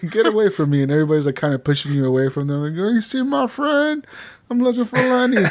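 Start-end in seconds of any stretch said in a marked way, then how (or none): 0.1-0.8 higher mock brush-off through laughter; laughing retelling. Drunk scene, laughing while retelling. 2.8-5.5 put-on drunk. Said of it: "as you get more drunk".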